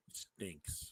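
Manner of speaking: whispering. Real, not acted. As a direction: breathe it out in a whisper. Whispered verdict.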